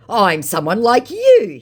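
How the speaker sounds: high, slow put-on voice; mocking the scammer's dodge. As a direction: say it like a sly scammer. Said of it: "he says".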